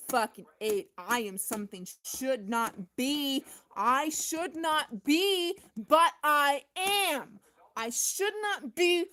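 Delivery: loud, slow, building outburst of defiance; ranting shout. Real, not acted. Ranting to the viewer about being the glitch, swelling into a shout.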